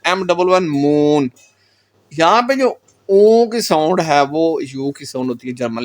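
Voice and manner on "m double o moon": मून with a long, drawn-out oo; long oo in moon. Shown as a demonstration. Teacher spells out moon to show the long vowel. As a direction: stretch the oo in मून slowly. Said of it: "मून को क्या pronounce करते हैं".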